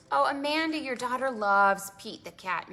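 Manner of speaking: plain reading voice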